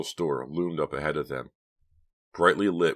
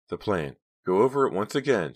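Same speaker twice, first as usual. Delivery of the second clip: a touch of fear, pitched up and louder; tense, tight-throated; 5 semitones higher, 5 dB louder than usual. A narrator's reading. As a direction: nervously press for the plan once more. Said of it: "her throat tightening".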